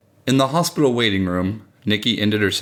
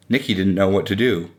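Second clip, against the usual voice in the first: ordinary delivery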